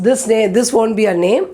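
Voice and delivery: ordinary talk, nothing marked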